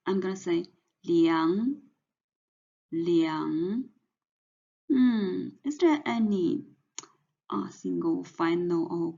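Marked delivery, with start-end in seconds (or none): 2.9-3.8 凉 in Mandarin second tone, rising. Shown as a demonstration. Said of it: "this is the second tone".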